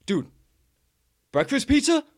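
high, loud eager ask; eager question. Retold or imitated. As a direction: tempt him with it. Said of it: "He goes".

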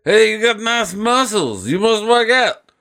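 high put-on voice; mock admirer. Admirer praising someone's muscles, high mock voice. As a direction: pitch it way up for a mock compliment. Acted mock compliment in a skit.

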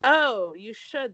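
normal talking voice

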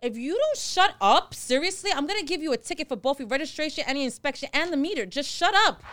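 high, re-enacted telling-off; exasperated warning. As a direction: act out an officer losing patience. Retells ticketing officer's warning.

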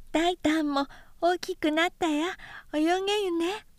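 put-on high, lisping toddler voice; high childish babble; small child. A narrator's reading. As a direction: chirp it like a little boy proud of himself.

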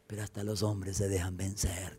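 susurrado sin voz, rendido; díselo en un susurro de despedida. Retold or imitated. Padre moribundo responde. (padre moribundo a sus hijos, susurrando)

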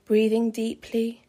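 ordinary talk

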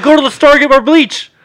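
high, loud frantic yell; panicked high-pitched shout; urgent yelling. Acted. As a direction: holler the demand at dad. Orders dad in retold panic.

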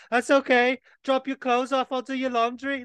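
high sing-song kindly voice; sweet mock Scouser. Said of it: "And they'd be like".

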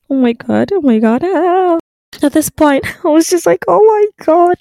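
high, rising panic, voice climbing; reliving the fright in a squeaky shriek; high frightened squeal; panicked squeal. Retold or imitated.

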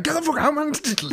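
high-pitched, exasperated order out; exasperated yell. Retold or imitated. Finally ordering the stranger out, exasperated high yell. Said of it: "I was like".

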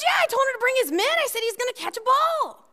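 high, fast and cheerful dad; a dad casually admitting his promise, breezy. Retold or imitated. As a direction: rattle it off like it's no big deal. Dad answers, voiced.

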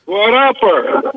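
echoing, like from a hallway. Real, not acted. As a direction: sound far off down a corridor. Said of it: "You sound like you're in the hallway".

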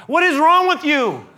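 the leader scolding his complaining people, loud; exasperated Moses. Retold or imitated. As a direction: let the frustration burst out on wrong. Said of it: "You can imagine Moses going".

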